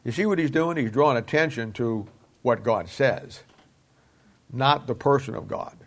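ordinary talk

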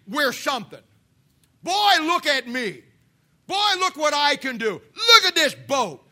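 mock boasting. Proud man boasting.

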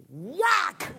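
high-pitched exclaimed whack; sudden whack. Acted. Sudden blow that startles the sleeper.

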